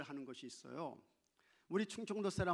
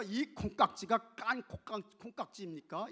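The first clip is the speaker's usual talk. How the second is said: tongue-twister stumble; 5 semitones higher, 5 dB louder than usual. Shown as a demonstration. Preacher shows how hard standard speech is, tongue-twister stumble. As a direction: labour through it, tripping on 콩깍지. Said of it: "이게 잘 안 돼".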